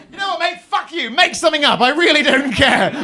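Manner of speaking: high, loud, fed-up rant, slowing at the end; exasperated outburst. Acted. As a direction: lose patience with the bore. Ranting at a dull conversationalist.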